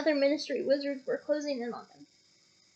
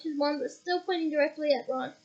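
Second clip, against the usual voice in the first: usual speaking voice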